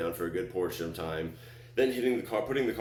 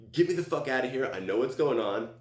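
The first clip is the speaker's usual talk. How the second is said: retold frantic demand, pitched up; raised, exasperated plea; frustrated; 6 semitones higher, 4 dB louder than usual. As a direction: insist urgently into the phone. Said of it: "I'm getting frustrated".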